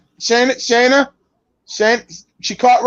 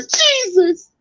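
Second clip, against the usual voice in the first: mock pained cry; 11 semitones higher than usual. Paul Bearer voiced in pain.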